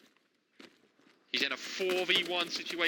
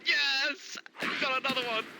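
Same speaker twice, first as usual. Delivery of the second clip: high, excited yell; excited cheer; 10 semitones higher, 3 dB louder than usual. Real, not acted. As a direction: cheer the kill. Caster cheering a kill.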